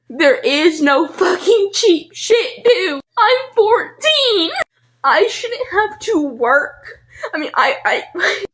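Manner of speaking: high, slow, broken and tearful; choking out the words between sobs; laughing through tears. Real, not acted. Teen venting at online commenters, crying and laughing at once.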